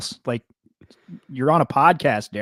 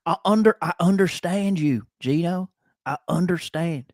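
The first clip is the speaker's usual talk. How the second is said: mock Southern accent; 5 semitones higher than usual. Cohost mocking Derek Carr's pep talk, Southern accent. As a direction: poke fun at the guest's Southern accent. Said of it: "His Southern accent from Bakersfield".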